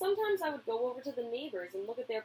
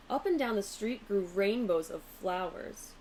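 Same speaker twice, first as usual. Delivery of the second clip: plain reading voice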